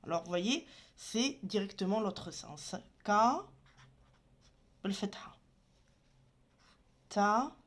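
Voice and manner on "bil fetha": whispered aside. Shown as a demonstration. Teacher spelling letters for students, whispered aside. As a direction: whisper the vowel name under your breath.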